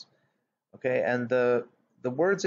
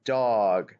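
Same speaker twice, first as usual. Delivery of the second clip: ordinary talk, nothing marked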